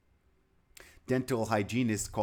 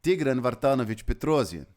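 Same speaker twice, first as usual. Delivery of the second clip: Russian pronunciation; 4 dB louder than usual. Shown as a demonstration. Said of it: "I could go all day".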